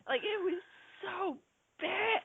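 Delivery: very high, soft, halting whine; comically disappointed. Real, not acted. Critic lamenting a bad movie to friends, high and disappointed. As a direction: squeak it out softly with pauses between words. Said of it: "You sound almost disappointed".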